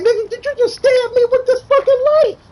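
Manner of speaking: high, loud, laughing outraged yell; pissed fighter voice. Retold or imitated. Friend retells the stabbed fighter's outburst, high laughing yell. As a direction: squeal it out in disbelief through a laugh. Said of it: "He like".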